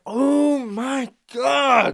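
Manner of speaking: slow, drawn-out shocked cry; mock horrified viewer; horrified gasp. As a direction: let it out like you can't watch. Reaction to man shot in hand.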